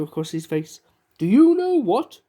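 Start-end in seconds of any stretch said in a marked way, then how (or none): 1.2-2.2 high, loud, slow cheerful question; cheerful boom. Acted. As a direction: boom it out happily. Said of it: "he boomed cheerfully".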